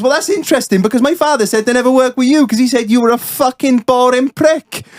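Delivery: high-pitched Welsh comeback, slowing on the last insult; Welsh accent. Retold or imitated. Welshman answering back with his father's words, Welsh accent. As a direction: retort as a sarcastic Welshman. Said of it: "I'll do my best Welsh accent".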